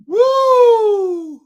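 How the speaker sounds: long, high, loud woo; loud whoop. Real, not acted. Recovering from a laughing fit, loud whoop. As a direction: let out a big falling whoop.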